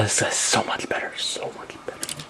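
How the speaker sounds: hushed, reverent whisper. Acted. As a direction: whisper it like a secret. Whispering praise for his favorite.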